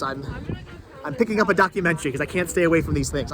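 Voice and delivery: ordinary talk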